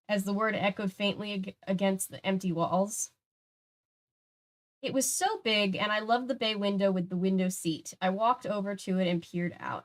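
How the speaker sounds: plain reading voice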